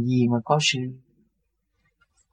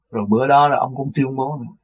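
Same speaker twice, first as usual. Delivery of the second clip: usual speaking voice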